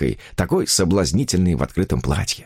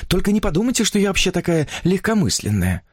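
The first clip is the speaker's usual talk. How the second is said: higher-pitched chattering heroine; infatuated young woman; 10 semitones higher than usual. A narrator's reading. Woman confiding her new romance to a writer, higher enraptured voice.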